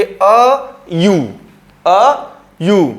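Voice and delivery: "अ यू" drawn out slowly, "यू" said as you; slow pronunciation demo. Shown as a demonstration. Teacher models "अ यू" slowly so students hear it. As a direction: say "अ यू" very slowly, sound by sound. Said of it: "इसका pronunciation यू से होता है".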